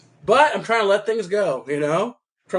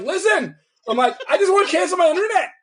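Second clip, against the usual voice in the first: pitched way up, pressing hard, fed up; exasperated demand; 13 semitones higher than usual. Retold or imitated. Fed-up customer insisting to the sales rep, exasperated. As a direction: snap at the pushy phone rep. Losing cool with phone rep.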